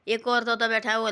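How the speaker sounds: usual speaking voice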